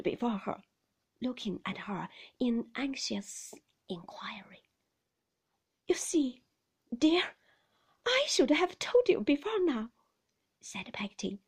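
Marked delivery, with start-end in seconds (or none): 5.9-9.9 raised, high confessing voice; nervous nurse. A narrator's reading. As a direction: explain uneasily to the child.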